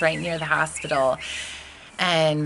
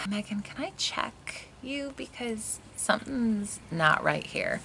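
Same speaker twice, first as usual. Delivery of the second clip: gentle midwife; 7 semitones higher, 7 dB quieter than usual. Retold or imitated. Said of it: "she just was like".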